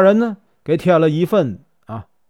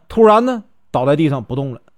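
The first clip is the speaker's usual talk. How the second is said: usual speaking voice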